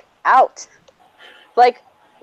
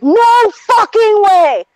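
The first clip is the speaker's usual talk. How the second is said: high, loud outraged yell; explosive drawn-out refusal; losing it; 12 semitones higher, 6 dB louder, much slower than usual. Retold or imitated. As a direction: shout it slowly in disbelief. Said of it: "And I lost it".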